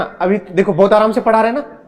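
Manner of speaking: usual speaking voice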